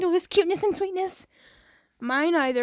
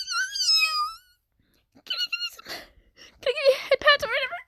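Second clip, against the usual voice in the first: put-on very high, squeaky child voice; high squeaky kid voice asking for a head pat; cute little girl voice; faster than usual. Voicing a cute girl character.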